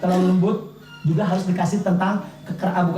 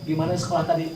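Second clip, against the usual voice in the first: put-on softer, relaxed "how was school?" to a child; gentle casual asking; 4 semitones lower, 6 dB quieter, faster than usual. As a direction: ask lightly like a curious parent, not a teacher. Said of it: "kayak mengajari".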